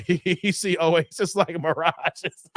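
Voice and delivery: slowed, words breaking into laughter; laughing through it. Real, not acted. Host reading a viewer's joke to chat, laughing. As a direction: read the joke while cracking up.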